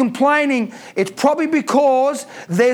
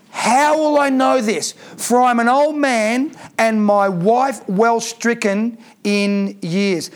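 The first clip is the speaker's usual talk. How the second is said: slow doubting reply; 2 semitones lower, slower than usual. Acted. Reading Zechariah's doubting reply.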